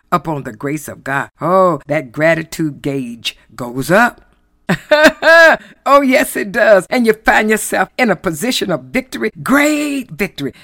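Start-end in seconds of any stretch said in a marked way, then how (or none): 4.7-5.6 loud, high burst of laughter; real laugh. Real, not acted. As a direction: laugh out loud between preached lines. Delighted preacher laughs mid-sermon.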